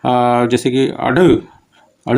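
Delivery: अड़ drawn out slowly with the tongue flicked; slow flap demo. Shown as a demonstration. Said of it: "उत्क्षिप्ति". (अड़ धीरे से फेंकते हुए)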